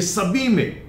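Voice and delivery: normal talking voice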